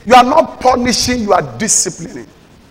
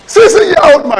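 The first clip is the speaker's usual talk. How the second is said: incredulous exclaim; 8 semitones higher, 6 dB louder than usual. Real, not acted. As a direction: cry out in amazement. Preacher exclaims in disbelief.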